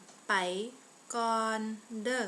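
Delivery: word by word with clear pauses. Shown as a demonstration.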